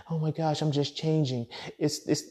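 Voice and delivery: high, put-on swooning woman voice; imitating a woman swooning over a masculine man; mock girly voice. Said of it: "women say they wanna be".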